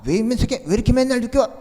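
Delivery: exasperated mother's shout; angry parent. Retold or imitated. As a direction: scold a child who came home late. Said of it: "엄마, 아빠는 화가 나요".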